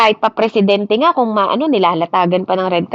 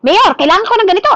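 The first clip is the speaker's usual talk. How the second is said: higher, louder, fast demanding yell; mock shout at the mayor; 8 semitones higher, 5 dB louder, faster than usual. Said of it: "Sisigawan mo ba siya?". (pabirong sigaw sa mayor)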